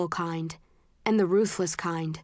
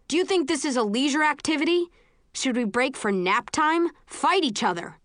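shouting; 7 semitones higher than usual. A narrator's reading. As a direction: bark it at the recruits. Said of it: "Eric shouts".

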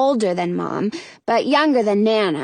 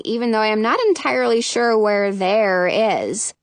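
usual speaking voice

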